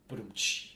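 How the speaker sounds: spoken drum-and-cymbal punchline sting; joke rimshot. Retold or imitated. Fan mocking misjudged sad moments, spoken joke rimshot. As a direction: imitate a rimshot after a bad gag. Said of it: "turned into".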